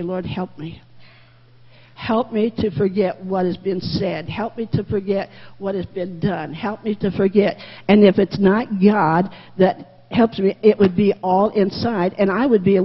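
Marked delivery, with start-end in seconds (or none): 0.0-7.6 soft, low prayer, pleading to the Lord; quiet pleading prayer. Acted. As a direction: pray it quietly, as if alone with God.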